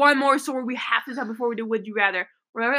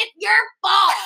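higher, louder mock blame through laughter; mock accusing yell; 8 semitones higher, 5 dB louder than usual. A friend retells blaming Emma for the bathing suit, mock accusing yell.

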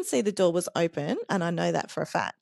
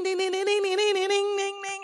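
doorbell imitation; 11 semitones higher than usual.

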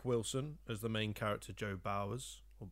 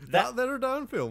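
high, loud hillbilly twang; goofy backwoods yokel voice; 14 semitones higher, 10 dB louder than usual. Pretend. Co-host joins the hillbilly bit.

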